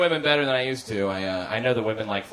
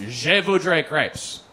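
French phrase; 4 semitones higher, 3 dB louder, much slower than usual. Shown as a demonstration. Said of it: "my phrase was".

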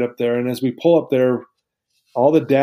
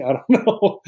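laughing; 6 semitones higher than usual. Real, not acted. Laughing at the idea of cheating.

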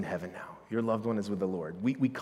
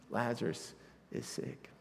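hushed plea; 9 dB quieter than usual. Acted. Sisters' urgent message to Jesus.

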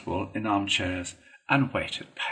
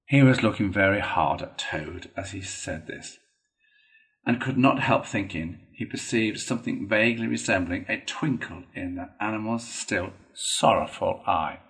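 ordinary delivery

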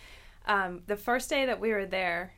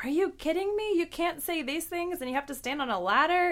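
high-pitched retold disbelief; re-enacted outraged questioning of the rules; incredulous protest; 9 semitones higher than usual. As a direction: act out your indignant disbelief, much higher. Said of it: "That's why I was like".

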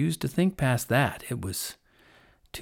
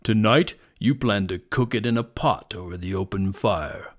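loud, weighty prophecy; serious lion; 6 dB louder than usual. A narrator's reading. Lion foretells the fire to hunter, serious. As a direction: lay out the doom with grave authority. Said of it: "with a serious voice".

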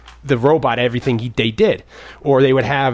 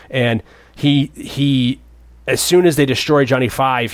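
usual speaking voice

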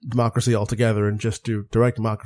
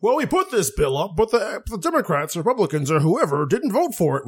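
mock spin-doctor voice, pitched up; mocking politician; 5 semitones higher than usual. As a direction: parrot a smug politician. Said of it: "they can say".

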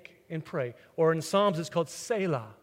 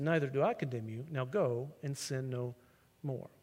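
tender forgiveness; 7 semitones lower, 10 dB quieter than usual. Acted. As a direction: let her go with quiet mercy. Jesus forgives the woman.

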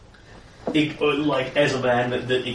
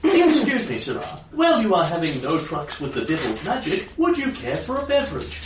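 high, prim servant voice; fussy high-pitched butler; polite butler voice; 7 semitones higher than usual. Acted. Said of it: "I'm going to do more of a robot voice".

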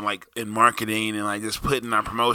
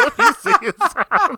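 laughing high; 7 dB louder than usual. Real, not acted. Laughing echo.

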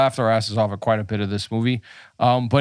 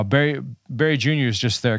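ordinary talk, nothing marked

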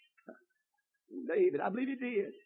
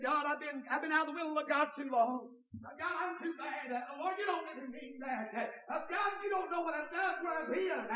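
a hint of a pleading, higher voice; doubting sinner; 10 semitones higher, 9 dB quieter than usual. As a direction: beg like someone who feels too far gone. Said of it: "He could have said".

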